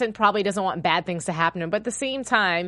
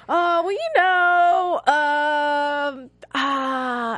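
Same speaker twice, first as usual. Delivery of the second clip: high, drawn-out hemming and hawing; mock-hesitant stammer, stretched out and raised; hesitating; 7 semitones higher, 4 dB louder, much slower than usual. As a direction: exaggerate stalling for an answer, long high fillers. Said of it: "I hesitated".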